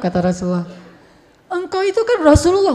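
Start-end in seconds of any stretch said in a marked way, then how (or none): 1.5-2.7 higher, louder retold scolding; indignant Aisyah. Wife scolds the Prophet over the baggage, indignant. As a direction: act out an offended wife's reproach. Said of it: "marah Aisyah".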